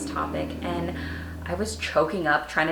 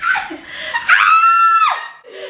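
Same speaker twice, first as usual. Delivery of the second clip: high, loud burst of laughter; shrieking laugh; 9 dB louder than usual. Real, not acted. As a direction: scream with laughter. Reacting to diary line.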